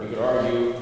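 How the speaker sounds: ordinary talk, nothing marked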